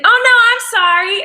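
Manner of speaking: embarrassed high apology; flustered apology. Retold or imitated. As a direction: blurt a sorry after a slip. Retold flub on set.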